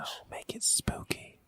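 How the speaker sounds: slow, hushed eerie whisper; soft, drawn-out spooky whispering. Pretend. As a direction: whisper it to give them chills. Asking listeners for spooky drawings.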